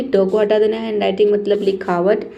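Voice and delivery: ordinary talk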